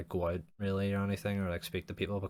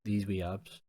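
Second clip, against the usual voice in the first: granda voice; slower than usual. Pretend. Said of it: "I sound like a fucking granda".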